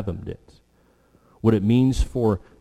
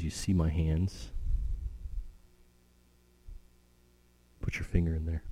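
soft invitation; 4 semitones lower, 8 dB quieter than usual. Acted. Jesus speaks to Thomas.

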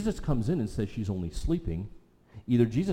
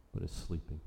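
quiet, softer repeat of the phrase; hushed echo; 5 semitones lower, 11 dB quieter than usual. Real, not acted. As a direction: repeat it much softer, almost to yourself. Echoed line, much quieter.

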